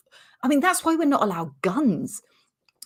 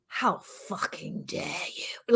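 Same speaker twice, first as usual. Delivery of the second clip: hissed under the breath, slow; seething silently inside your head; furious whisper; 8 dB quieter than usual. Retold or imitated. Brain fumes at the rude shopper, whispered. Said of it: "while your brain is saying".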